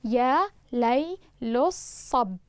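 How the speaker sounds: يا ليل الصب split into very slow syllables; slow scansion. Shown as a demonstration. Teacher scanning يا ليل الصب for students, very slow. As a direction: chop يا ليل الصب into metrical beats. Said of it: "والحرف الثاني متحرك سيأتي مع الباء".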